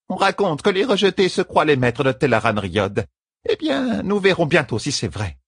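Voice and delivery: high-pitched, challenging retort; confident matriarch. A narrator's reading. Matriarch answering the warning about adversaries, confident. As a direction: answer as an undaunted elder.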